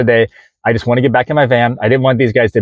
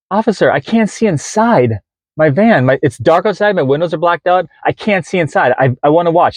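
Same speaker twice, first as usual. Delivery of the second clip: pleading with officer; 6 semitones higher than usual. Retold or imitated.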